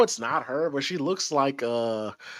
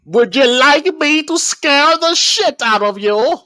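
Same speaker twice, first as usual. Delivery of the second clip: put-on higher, playful London accent, spooky tease; 10 semitones higher, 4 dB louder than usual. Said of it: "Very London-like accent".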